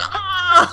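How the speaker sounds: high-pitched startled scream; reenacted scream. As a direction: scream as a chair flies at you. Reacts to flying chair in story.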